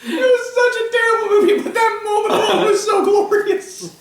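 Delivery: high, squealing words through laughter; real helpless laughing. Real, not acted. Delighted by one glorious movie moment, laughing hard. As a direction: crack up while you talk. Cracking up over the movie's climax.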